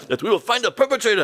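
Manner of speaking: high-pitched, melodramatic promise; overacting guard. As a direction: ham up the security man's vow. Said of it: "One of the guys was overacting".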